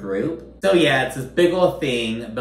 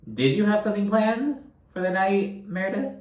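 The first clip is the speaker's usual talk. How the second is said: mock sarcastic question; 9 semitones higher than usual. Mocking Whitney on behalf of Meredith.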